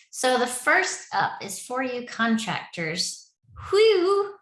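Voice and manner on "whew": a loud high whew of relief; relieved whew. Real, not acted. Project lead telling contractors the contract finally cleared, loud whew. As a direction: blow out the relief, big and bright.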